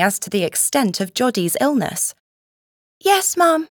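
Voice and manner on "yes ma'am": high-pitched little lad's reply; dutiful boy. A narrator's reading. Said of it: "recited Paddy".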